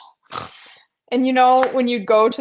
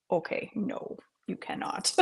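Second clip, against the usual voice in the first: whispered no; 3 semitones lower, 13 dB quieter than usual. Retold or imitated. Telling daughter no, whispered.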